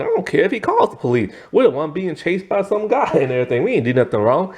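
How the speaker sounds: high, fast and indignant, a mock protest; indignant girl. Sue protesting to the security guard, high and fast. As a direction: rattle it off like a defiant kid. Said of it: "And Sue's all like".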